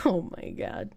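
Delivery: low, hushed laughing; laughing under breath. Real, not acted. Streamer laughing at own joke, quiet and low.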